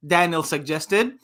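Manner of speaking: ordinary delivery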